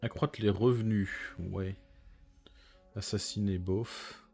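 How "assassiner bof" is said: normal talking voice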